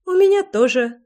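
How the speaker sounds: high-pitched little scoff; amused huff. A narrator's reading. Loy answering about tampons, with a huff. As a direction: toss it off with a huff. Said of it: "хмыкнула Лой".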